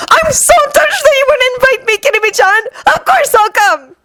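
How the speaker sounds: weepy overexcited outburst; jubilant tearful exclaim. A narrator's reading. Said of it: "exclaiming jubilantly".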